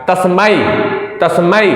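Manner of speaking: तस्मै with a clear आई diphthong at the end. Shown as a demonstration. Teacher stressing the ai vowel in तस्मै. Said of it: "आई आना चाहिए last में".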